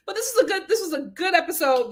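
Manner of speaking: ordinary talk, nothing marked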